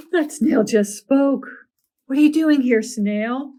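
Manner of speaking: loud, high startled question. Acted. Ari startled, demanding why the snail is there.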